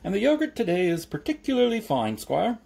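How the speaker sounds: high, chirpy sales patter; encouraging milkman. A narrator's reading. Mister Soak pushing yogurt on Jeremy, encouraging. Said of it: "said Mister Soak encouragingly".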